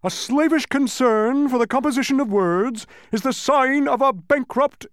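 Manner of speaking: very high, loud grandiose roar; pompous Humbug, retorting. A narrator's reading. Humbug retorts to the bee, loud and pompous. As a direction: proclaim it with full bluster.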